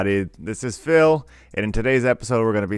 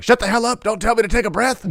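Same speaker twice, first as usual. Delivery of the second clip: hammed-up high, loud snap back; parodying his own stressed-out outburst; mock angry outburst; 13 semitones higher, 4 dB louder than usual. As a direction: yell it at the kids in mock fury. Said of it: "I'm like".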